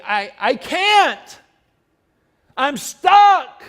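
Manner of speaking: high, loud pleading voice; whiny stuck person. Acted. Person sinking in the mud answering back, high loud pleading. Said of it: "And they may say".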